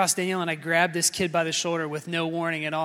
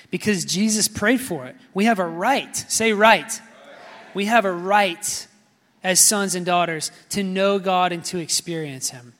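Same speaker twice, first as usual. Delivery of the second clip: louder, rousing sermon voice; preaching; 4 dB louder than usual. Acted. Pastor rallying the church to claim permission, preaching full force. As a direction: drive it home to the congregation.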